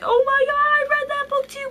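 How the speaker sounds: excited, very high-pitched squeal; really high voice. Acted. Gushing to a friend about a shared book, high excited squeal. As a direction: squeal it like a thrilled fan, way up high. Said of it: "My voice got really high there".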